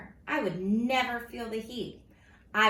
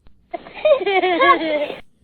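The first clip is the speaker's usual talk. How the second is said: loud high burst of laughter; 11 semitones higher, 15 dB louder than usual. Real, not acted. Reader cracking up at the story, loud laugh. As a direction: laugh out loud, can't hold it.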